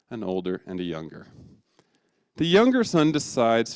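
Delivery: usual speaking voice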